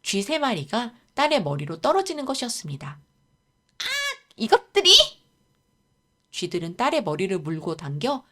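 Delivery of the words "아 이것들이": high, loud exasperated scream; angry shriek. Acted. Daughter attacked by rats, angry shriek. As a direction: cry out, then lash out furiously.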